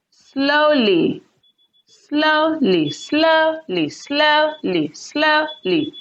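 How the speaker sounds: SLOWly, SLOW high and long, ly falling; stress on first syllable. Shown as a demonstration. Teacher drilling an adverb, SLOW in slowly stressed high. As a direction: punch SLOW in slowly each time, drop the ly. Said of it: "That is where the stress will fall".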